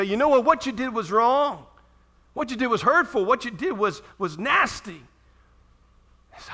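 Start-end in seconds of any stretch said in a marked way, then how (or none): 0.0-5.1 raised, firm scolding of the offender; stern rebuke. Acted. Preacher playing the wronged person, firmly rebuking. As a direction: confront them, pitch up and loud. Confronting the offender in role play.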